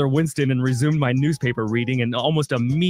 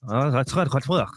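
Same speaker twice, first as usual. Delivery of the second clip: mock French. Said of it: "you're still doing it".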